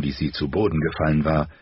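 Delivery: ordinary delivery, nothing marked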